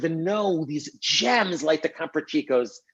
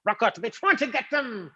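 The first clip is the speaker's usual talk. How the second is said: put-on Cockney accent; 6 semitones higher than usual. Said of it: "talking in a Cockney accent".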